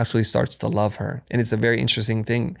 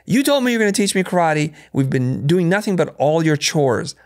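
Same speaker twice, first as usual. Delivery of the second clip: higher-pitched, exasperated protest; frustrated student; 6 semitones higher, 3 dB louder than usual. Retold or imitated. Student protesting to his karate teacher about chores, frustrated. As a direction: imitate a fed-up pupil complaining to the teacher. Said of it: "he gets really frustrated".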